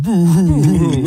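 hammed-up fake sobbing; mock crying. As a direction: whimper it like a crybaby. Mocking Danny as a crybaby.

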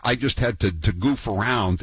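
ordinary delivery

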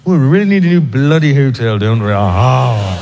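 mock British toff complaining; posh British colonial. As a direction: colonial Brit grumbling, mildly hammed. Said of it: "I'm sure they sat around".

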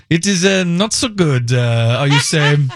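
hesitant mock French accent; bad French accent. Host quoting a Frenchman on the beer, bad French accent. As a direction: imitate an unimpressed Frenchman's accent. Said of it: "this bad French accent".

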